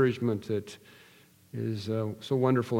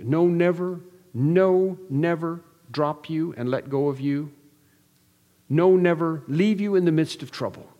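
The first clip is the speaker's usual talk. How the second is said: fervent preaching; 4 semitones higher than usual. Acted. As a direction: hammer the promise home to the congregation. Preaching at full force.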